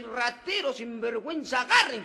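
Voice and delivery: put-on old lady yelling thief, building to a loud cry; mock shout. Robbed old lady yelling after the thief, loud. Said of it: "Voltea así".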